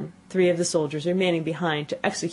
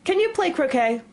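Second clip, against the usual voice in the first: high, ringing shouted question; sustained high-pitched royal shout; 11 semitones higher, 3 dB louder than usual. A narrator's reading. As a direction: call it out as the imperious Queen. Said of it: "shouted the Queen".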